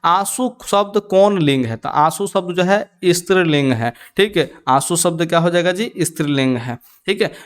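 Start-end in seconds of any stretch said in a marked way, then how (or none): none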